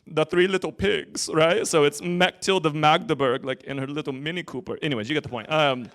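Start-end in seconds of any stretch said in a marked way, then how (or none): 0.0-1.3 loud joke German accent. Shown as a demonstration. Preacher showing the congregation German, German accent. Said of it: "this is my German accent". 2.0-4.8 hammed-up German accent. Shown as a demonstration. Preacher joking about a German mystic, German accent. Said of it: "this is my German accent".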